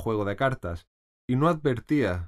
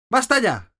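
much higher and louder shriek; 13 semitones higher, 8 dB louder than usual. A narrator's reading. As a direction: shriek at them to stop. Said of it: "chilló la reina". (chillido furioso, muy agudo y fuerte)

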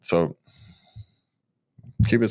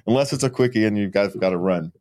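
ordinary talk, nothing marked

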